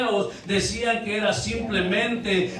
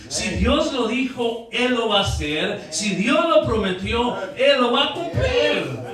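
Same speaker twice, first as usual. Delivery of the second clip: rising preacher's proclamation; preaching crescendo; 4 semitones higher than usual. Acted. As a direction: rise to a fiery declaration. Preacher builds to climax.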